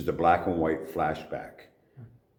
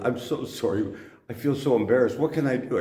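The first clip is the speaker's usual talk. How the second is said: rising higher, a mock flustered apology; embarrassed apology; 7 semitones higher, 3 dB louder than usual. Actress mock apologizing to the underpaid actor, flustered. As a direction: gush a hammed-up sorry. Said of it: "she goes".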